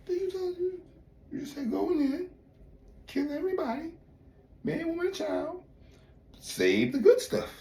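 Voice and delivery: high-pitched mock Saul protesting. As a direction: play Saul as a whiny pleading king. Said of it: "Saul said".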